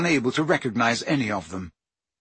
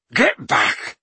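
shouting; 2 semitones lower, 3 dB louder than usual. Acted. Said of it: "shouted Hagrid".

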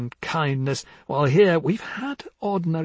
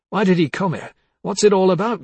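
ordinary talk

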